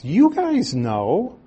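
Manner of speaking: slow, high-pitched emphasis on "You guys"; emphatic appeal. Acted. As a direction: press "You guys know" on the listeners slowly. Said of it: "he's being emphatic to his wives".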